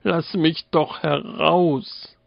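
high, drawn-out pitiful pleading; plaintive cry. A narrator's reading. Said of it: "Der Geist rief kläglich".

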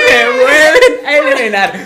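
high, loud laughing disbelief. Real, not acted. Retelling being eliminated again.

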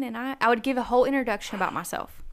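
ordinary talk

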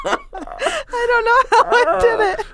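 high, slow, laughing through words. Real, not acted. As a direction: giggle through the line. Laughing after turkey noise.

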